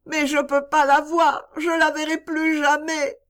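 put-on high, sobbing child's cry; a little girl who will never see her friend again. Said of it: "Et Topsy sanglota de nouveau".

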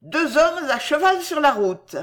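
put-on high, projected child's report; calling through cupped hand. A narrator's reading. Child in the tree to officer below, calling out. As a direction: call down from a height. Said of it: "faisant de sa main un porte-voix".

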